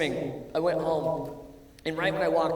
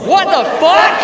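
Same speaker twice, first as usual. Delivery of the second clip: shocked outburst; 10 semitones higher, 8 dB louder, slower than usual. Retold or imitated. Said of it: "I was like".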